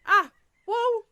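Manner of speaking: high loud yelp of alarm; real startled yelp; startled shriek. Real, not acted. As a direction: shriek while losing balance. Nearly falls stepping in.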